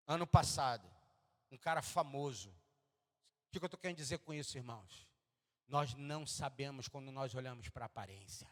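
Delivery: normal talking voice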